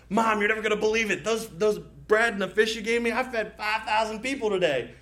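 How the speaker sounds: put-on high, eager little boy bragging to mom; wide-eyed boy telling mom his news; excited kid. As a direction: gush it out like a thrilled child.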